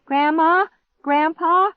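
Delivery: high, loud searching calls; yelling. A narrator's reading. Girl searches for grandparents, yelling. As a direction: yell through the house. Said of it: "I yelled".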